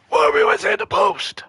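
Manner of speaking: loud, high, strained complaint; exasperated cry. Real, not acted. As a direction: throw up your hands and moan it. Exasperated after hitting post.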